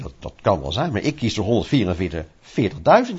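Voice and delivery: low, calm, settled answer; lightly voiced Lord, unbothered. Preacher voicing the Lord's reply to the complainer, low and calm. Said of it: "zegt de Heer".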